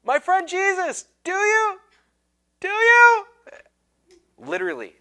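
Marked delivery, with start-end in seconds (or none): 0.0-3.2 high, loud, climbing desperate yell; desperate shout. Retold or imitated. Student yells after strangers walking away, hand raised, desperate. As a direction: call after them, louder each time. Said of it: "And I'm all, hand raised".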